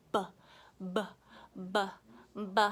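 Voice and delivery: "B" said as short "buh"; "B" as clipped phonic buh; letter sound. Shown as a demonstration. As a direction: pop out "B" as buh, each separate. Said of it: "What does, sound does a bee make".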